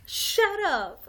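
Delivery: sudden high, loud yell; a girl finally standing up for herself, shouting. Retold or imitated. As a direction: snap it out at the bullies. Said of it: "was like".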